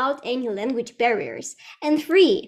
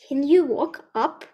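ordinary talk

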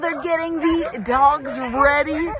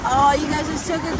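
ordinary talk

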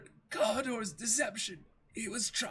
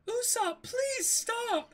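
high, pleading cry; frightened plea; much slower than usual. Acted. Frightened Kaya begs Usopp to stop, high and pleading. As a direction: beg him to stop, scared.

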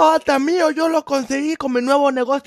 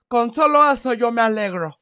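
normal talking voice

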